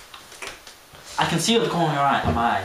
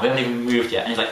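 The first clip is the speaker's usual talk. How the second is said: ordinary talk, nothing marked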